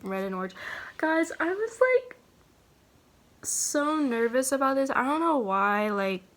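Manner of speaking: ordinary delivery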